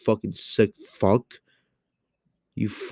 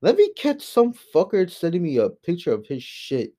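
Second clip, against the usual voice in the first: higher, heated threat, pitch jumping up; indignant outburst; 7 semitones higher than usual. Real, not acted. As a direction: let it burst out in disgust. Indignant threat about pictures.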